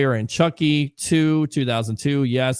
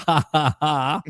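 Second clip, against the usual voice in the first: laughing; 3 semitones higher, slower than usual. Real, not acted. Laughs at waiver joke.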